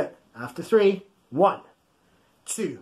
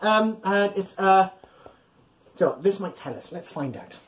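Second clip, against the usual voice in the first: ordinary talk, nothing marked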